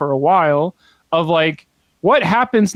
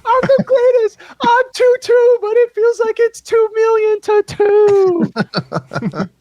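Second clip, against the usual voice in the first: much higher, louder, mock-boastful cheer; gloating triumph; 3 dB louder, slower than usual. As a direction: crow like a champion. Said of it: "And it was like".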